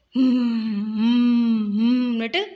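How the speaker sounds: slow, long humming owl calls; owl's hoot. Retold or imitated. Teacher showing children how owls sound at night, slow hooting hum. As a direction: imitate an owl with drawn-out closed-mouth hoots. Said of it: "ஆந்தைகளோட சத்தத்தை கேட்டிருக்கீங்களா".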